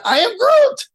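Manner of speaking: high-pitched, drawn-up exclamation; exclaimed door greeting. Acted. Player opening the door to arriving friends, goofy exclaimed greeting. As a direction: greet them in a silly high voice. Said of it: "I opened the door and I said".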